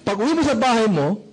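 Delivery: ordinary delivery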